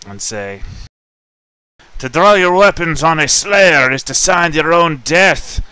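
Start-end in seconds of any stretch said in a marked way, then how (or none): 2.0-5.6 loud threatening warrior shout; menacing shout. Acted. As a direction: bellow the threat down the stairs. Character threatens guards at door.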